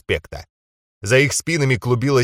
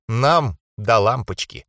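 ordinary delivery, nothing marked

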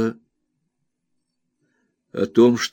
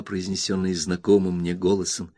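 usual speaking voice

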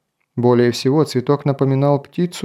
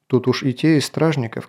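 ordinary delivery, nothing marked

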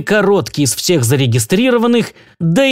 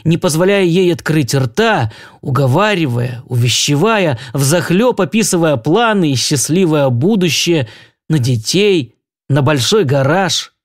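ordinary delivery

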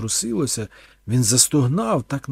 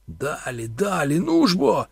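urging on; 6 semitones higher, 5 dB louder than usual. A narrator's reading. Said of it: "підганяв Торн".

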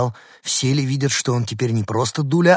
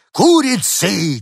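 much higher, loud and slow insult; shout; 10 semitones higher, 7 dB louder, much slower than usual. Acted. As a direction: yell it to scatter them. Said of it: "крикнул он".

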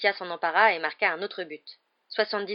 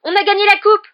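loud, much higher cheering chant; joyful shouting; 17 dB louder than usual. Acted. Chasers shouting to Harry that they won, loud and high. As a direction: cry out the win together. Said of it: "qui criaient".

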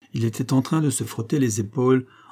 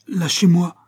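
a strangled, hissing plea, louder and higher; convulsive choked hiss; 7 semitones higher, 6 dB louder than usual. A narrator's reading. Groom begs Aurora to let go, strangled hiss. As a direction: groom gasping at the woman gripping him, choked hiss. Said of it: "dit-il convulsivement de sa voix étouffée".

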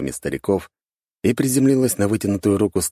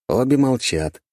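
ordinary delivery

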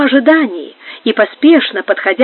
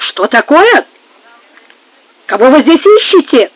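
higher, indignant challenge; narrator's moderately raised outcry; crying out; 4 semitones higher, 4 dB louder than usual. A narrator's reading. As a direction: demand it sharply in a raised voice. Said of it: "вскричал, хватаясь за шпагу".

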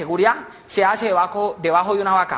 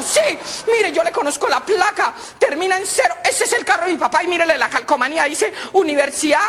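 put-on indignant young woman; 10 semitones higher than usual. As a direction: rant like a betrayed daughter. Girl voice, indignant.

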